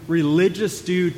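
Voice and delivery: usual speaking voice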